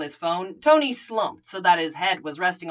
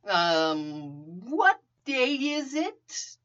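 slow, groggy and confused; dazed sleepy voice; 3 semitones higher, 4 dB quieter, much slower than usual. A narrator's reading. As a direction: drag it out, half awake. Sleepy Tony, dazed question.

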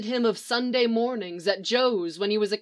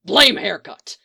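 loud barked snarl; gruff snarl; 7 dB louder than usual. Acted. Mick caught hugging Leonard, loud gruff snarl. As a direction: snarl it out while still hugging. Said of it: "Mick snarled".

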